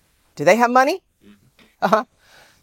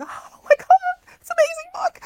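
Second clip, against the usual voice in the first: high, breathy-free squeal of delight; gushing fan. Retold or imitated. Speaker to audience, recalling reading the book, gushing high. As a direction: gush like a starstruck fan.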